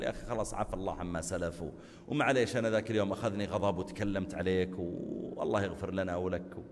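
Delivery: low and soft, sincere reconciliation; gentle apology. Shown as a demonstration. Making peace with a friend after an angry quarrel, gently. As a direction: make peace softly with your friend.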